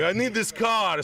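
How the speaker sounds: high, slightly drawn mock Russian; Russian accent. Russian exporter who speaks no English, high mock-Russian insistence. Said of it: "they're like".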